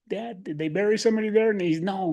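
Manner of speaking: usual speaking voice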